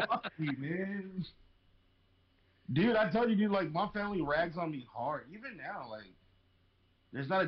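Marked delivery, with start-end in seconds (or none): 0.1-1.2 laughing through a mock fuck you; half-laughing, slowed down; a mock curse broken up by laughter; laughing retort. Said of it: "I was like".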